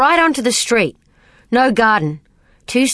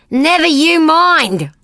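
higher, louder, slow snap of refusal; loud retort; 8 semitones higher, 4 dB louder, slower than usual. A narrator's reading. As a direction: shut the question down hard and slow. Grace snaps at prying question.

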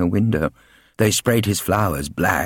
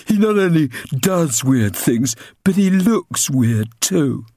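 put-on high, sneering boy's voice, slowing at the end; bully boy Ginger; 9 semitones higher than usual. Ginger sneering to his friends about the old man, bully voice.

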